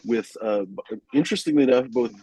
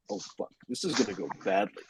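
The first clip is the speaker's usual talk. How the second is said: a quiet dread to himself; muttered inner thought; 2 semitones lower, 10 dB quieter than usual. Retold or imitated. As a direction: say it under your breath as the cop pulls up. Thinking to himself as police approach.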